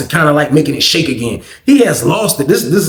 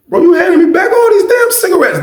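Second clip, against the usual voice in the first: shocked disbelief; 13 semitones higher than usual. Retold or imitated. Friend stunned to get the cigarettes handed back, shouting in disbelief. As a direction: blurt it out like you can't believe it.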